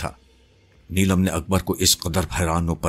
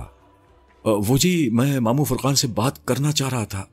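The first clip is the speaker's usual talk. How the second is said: higher-pitched, timid stammering start; frightened, hesitant; 7 semitones higher than usual. A narrator's reading. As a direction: answer meekly, as if scared of her. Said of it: "بڑی آہستگی سے بولا". (خوفزدہ ہو کر، جھجکتے ہوئے)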